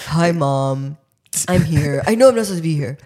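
lightly voiced dog talking to mom; a hint of a sheepish pet dog; imagined pet voice. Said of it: "That's how I envision her".

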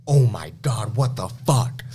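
loud, alarmed outburst from a stunned father; dad's shocked reaction. Retold or imitated. Father reacting to his injured son, loud shock. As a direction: blurt it out loud like a panicked dad. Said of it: "his reaction is exactly like this".

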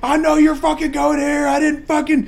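high, loud phone tirade; acted angry dad. Retelling dad phoning his son about changed plans, acted fury. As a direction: rage at a kid in hammed-up indignation. Acting furious dad's phone call.

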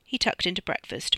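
plain reading voice

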